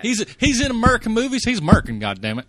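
play-angry insistence, half joking; mock outrage. Mock outrage defending the actor.

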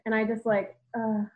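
normal talking voice

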